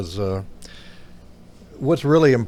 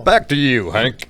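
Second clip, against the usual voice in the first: loud announcer handoff; radio voice; 2 semitones higher, 8 dB louder than usual. Pretend. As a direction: throw it back to the anchor, booming. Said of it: "start talking like that everywhere".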